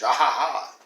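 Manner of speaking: mock evil laugh. Mimicking villain's triumph.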